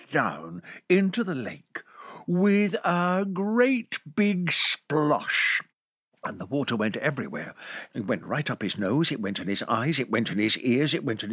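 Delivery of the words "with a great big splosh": very slow, stretched "great big splosh"; storyteller savouring the splash; drawn-out splash. A narrator's reading. Narrated splash.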